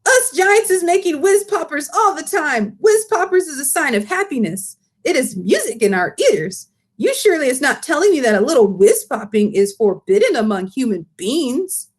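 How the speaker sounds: high, eager giant voice with muddled grammar; the BFG lecturing, indignant; big friendly giant. Acted. Giant praises whiz popping.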